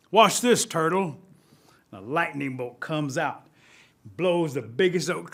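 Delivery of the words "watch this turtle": loud, high commanding call; voice from the clouds. Acted. Thunder calling to turtle from the clouds, commanding. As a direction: call down from the sky, voice raised. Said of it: "hears this voice come from the clouds".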